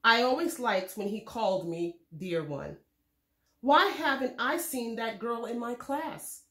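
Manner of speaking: usual speaking voice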